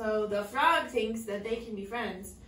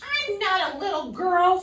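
much higher and louder, offended; huffy princess; 9 semitones higher, 14 dB louder than usual. A narrator's reading. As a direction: snap back like an indignant princess. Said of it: "she said huffily".

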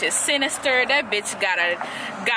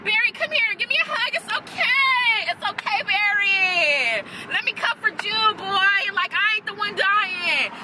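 mock comforting Iris; 7 semitones higher than usual. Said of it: "she found out that Savitar was Barry".

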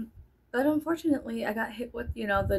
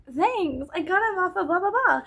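higher, louder bright thank-you; imitating herself chirpy; 10 semitones higher, 6 dB louder than usual. Vlogger retells answering a rude girl, high chirpy reply. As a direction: chirp it back brightly, faking sweetness. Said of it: "I was like".

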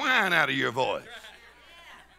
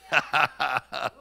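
low, staccato laugh; low chuckle; 8 semitones lower than usual. Real, not acted. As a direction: chuckle in separate pulses. Laughing after the whoop.

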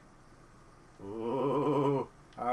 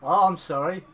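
loud mock-apologetic retort; sarcastic apology; 8 dB louder than usual. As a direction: answer a scolding with sarcasm. Sarcastic retort to scolding character.

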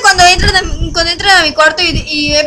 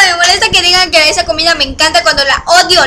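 ordinary talk, nothing marked

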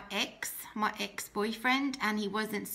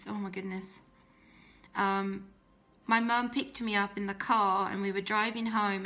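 normal talking voice

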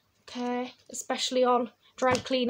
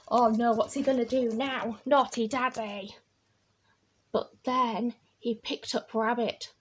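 usual speaking voice

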